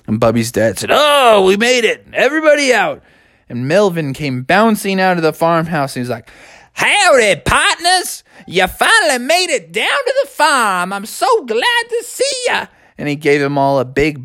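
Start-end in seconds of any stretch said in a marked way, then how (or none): 0.9-3.0 high, jolly dad; cheery dad voice. A narrator's reading. 6.7-12.7 high, folksy howdy farmer; country uncle voice. A narrator's reading. Farmer uncle greets them.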